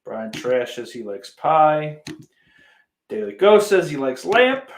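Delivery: ordinary talk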